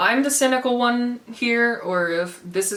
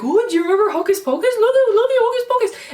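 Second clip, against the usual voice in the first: high, loud, fast and sugary mock pitch; hammed-up sugary corporate voice, much higher; mock Disney sales pitch; 14 semitones higher, 4 dB louder, faster than usual. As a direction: coo it like a cloying ad selling nostalgia. Mocking Disney selling nostalgia.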